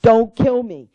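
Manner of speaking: loud, slow yell, imitating a condemned man; retold desperate yelling. Condemned prisoner pleading with the guards at his cell bars, retold yell. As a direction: cry out each word slowly, as a retold plea. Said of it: "he begins to yell and scream".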